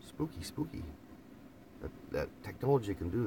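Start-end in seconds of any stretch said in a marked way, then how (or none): 0.1-0.8 high, airy, much softer mock ghost; haunt the word spooky; teasing mock ghost voice; ghostly spooky voice. Repeats spooky for effect.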